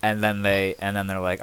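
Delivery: ordinary talk, nothing marked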